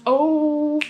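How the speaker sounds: usual speaking voice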